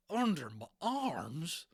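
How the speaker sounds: high, loud, slow cowboy yelp; horrified cowboy. Acted. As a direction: stark horror, cowboy voice. Boone horrified.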